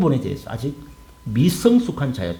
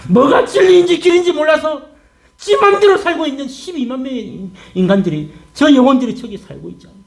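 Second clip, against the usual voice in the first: high, loud pleading; soaring, urgent cry easing slightly downward; impassioned God; 10 semitones higher, 4 dB louder than usual. Acted. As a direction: plead for lost people at full preaching pitch. God pleads passionately for the city.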